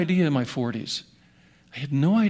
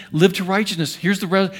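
normal talking voice

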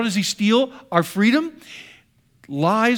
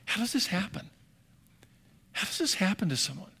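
hushed wonder; 10 dB quieter than usual. Retold or imitated. Congregation's bewildered question, hushed.

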